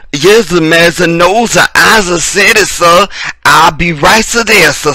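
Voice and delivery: mock servile slave. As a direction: ham up the bowing servant from old films. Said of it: "reject this, uh, portrayal when you see slave movies".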